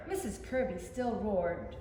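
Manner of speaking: usual speaking voice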